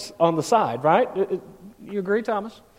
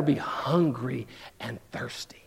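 hushed whisper; 7 semitones lower, 8 dB quieter, slower than usual. Acted. Preacher whispering the last beatitude to his church. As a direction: drop to a whisper for the last line.

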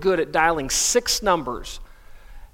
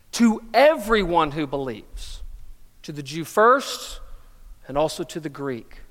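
scripture reading. Acted. Reading Romans 1:16 aloud.

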